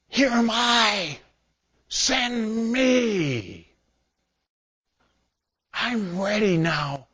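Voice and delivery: high, slow, tearful "here am I"; Isaiah answering God's call, slow and tearful; tearful offering. Acted. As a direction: offer yourself with a catch in the voice.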